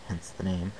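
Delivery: usual speaking voice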